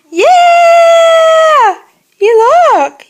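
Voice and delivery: loud, high delighted cheer; excited shout. Real, not acted. Baby responds, parent cheers.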